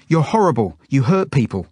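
put-on loud, high-pitched child's outburst; yelled accusation. A narrator's reading. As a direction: hurl the accusation at the captor. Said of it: "she yells at the woman".

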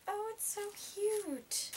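high, slow gushing coo. Real, not acted. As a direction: melt over how cute it is. Gushing over the blouse.

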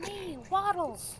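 slow, very high cartoon voice; squeaky high voice. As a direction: stretch it out in a tiny squeak. Squeaky pitched-up character voice.

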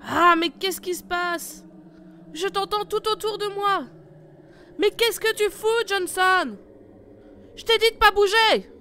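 loud, high, confused yelling; alarmed shout. Acted. Leader lost in echoes, confused angry shouting. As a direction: shout back, bewildered and angry.

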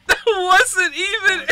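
high, loud, slow words broken by laughing sobs; half laughing, half crying in disbelief. Real, not acted. Gamer reacting to an unfair ending, laugh-crying high and loud.

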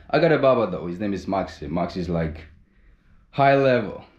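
normal talking voice

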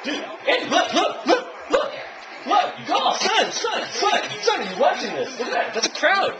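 grabbing his buddy's attention; laughing excited chatter; excited calling out. Real, not acted.

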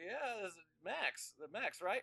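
put-on high, slow cranky old man; Max voice. Actor on the phone, high cranky voice. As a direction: stretch it out high and fussy. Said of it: "do the Max voice for all this".

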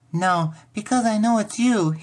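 put-on high little-girl voice, amused; giggly child. A narrator's reading. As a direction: answer as a tickled small girl. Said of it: "Millie giggled".

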